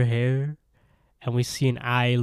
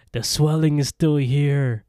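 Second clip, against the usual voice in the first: loud, higher demanding cry; acted-out urgent command, raised voice; calling out; 4 semitones higher, 6 dB louder than usual. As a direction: call out insistently for help. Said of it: "calling out for the two goons".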